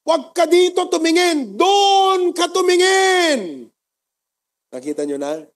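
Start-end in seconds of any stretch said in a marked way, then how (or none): 0.1-3.7 high, loud, slow scolding yell; angry shout. Retold or imitated. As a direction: bellow it at him, drawn out. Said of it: "Sabi nung pastor".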